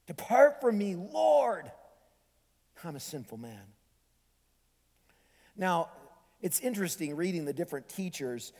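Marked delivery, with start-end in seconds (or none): 0.0-1.7 high, loud cry to the Lord; awed plea. Acted. Peter shattered by the miracle, awed plea. 2.8-3.7 low, soft admission; humbled confession. Acted. Peter confesses to Jesus, humbled and hushed.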